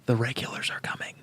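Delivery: secret whisper. Retold or imitated. Rider secretly warning prepared neighbors, whispered. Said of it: "in a quiet ... way not to draw attention".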